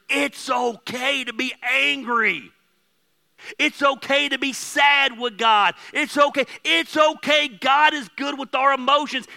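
high, loud, slow preaching; impassioned preaching. Acted. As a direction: pour it out with conviction.

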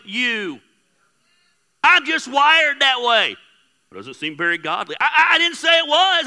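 fiery pulpit delivery; full-force preaching. Acted. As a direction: preach it hard to the congregation.

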